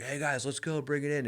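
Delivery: high, softened rallying call; imitated calling-out. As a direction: mimic calling the guys over without volume. Repeats imitation of gathering call.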